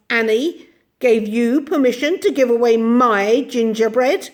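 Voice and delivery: put-on slow, high, menacing old woman; fierce and terrifying. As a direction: drag out each word to frighten. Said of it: "in a soft, fierce, terrifying voice".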